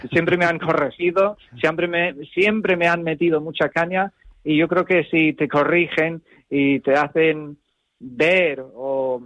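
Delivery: normal talking voice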